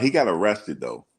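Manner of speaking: ordinary delivery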